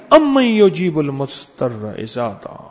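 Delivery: slow recitation. Acted. Quranic verse recited in story.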